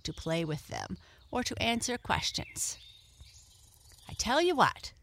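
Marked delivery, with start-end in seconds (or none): 4.1-4.9 high, loud idea; eager cry. A narrator's reading. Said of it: "cried one".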